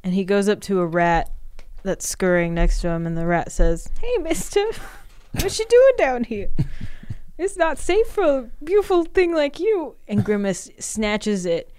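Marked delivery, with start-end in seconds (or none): 4.0-9.9 much higher, tiny rodent voice; squeaky rat voice. Acted. Sewer rat greets Grimace, high squeaky voice.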